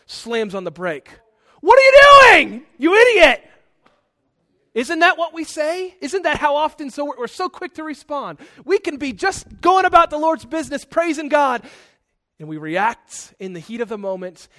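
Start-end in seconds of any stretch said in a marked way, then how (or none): 1.6-3.5 furious shout, very high and loud; angry yell. Acted. Acted road rage after braking. 4.7-11.7 loud, high-pitched preacher's challenge; impassioned preaching. Real, not acted. Preaches forcefully at raised pitch.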